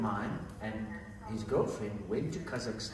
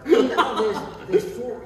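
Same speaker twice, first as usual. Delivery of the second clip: laughing; 11 semitones higher, 12 dB louder than usual. Real, not acted. Laughing while explaining.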